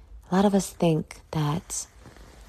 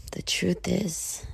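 confiding whisper; 6 dB quieter, slower than usual. Real, not acted. Confessing hard times.

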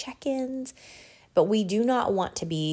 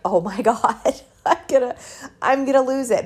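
louder, higher, a laugh breaking through; laughing exclamation; 5 semitones higher, 6 dB louder than usual. Retold or imitated. To her son about the paint tracks, laughing half-panic. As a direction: laugh while pretending to panic. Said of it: "But instead, I just laughed".

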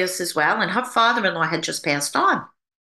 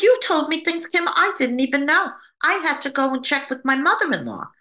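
excited; 7 semitones higher than usual. Retold or imitated. Friend's excited call retold.

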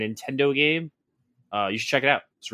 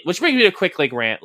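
usual speaking voice

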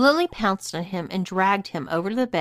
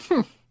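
short, high muffled "humph"; gagged grunt; 9 semitones higher, 4 dB louder than usual. Acted. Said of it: "he grunted into his gag".

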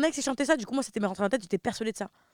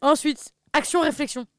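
announcer; 6 semitones higher, 5 dB louder than usual. Real, not acted. Announcing next word like a host.

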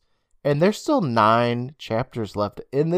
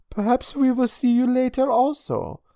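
put-on high, lilting European-accented farewell; 12 semitones higher than usual. A narrator's reading. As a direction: take leave politely in a European accent. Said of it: "in her heavy European accent".

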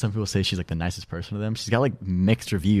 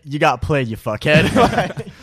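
loud, high jeer with laughter; joking friend's taunt; mock taunting; 6 semitones higher, 9 dB louder, much slower than usual. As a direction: rub it in, drawing out the insult. Playing friends' blunt post-breakup reveal.

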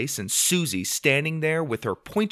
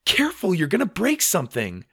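high-pitched sisterly warning; mildly scolding caution; worried warning; 7 semitones higher than usual. A narrator's reading. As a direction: warn a reckless brother. Sister warns reckless brother.

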